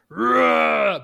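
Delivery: loud, high guttural roar; imitated guttural yell of a monster. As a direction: let out a wordless creature scream. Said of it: "thing just yelling a guttural".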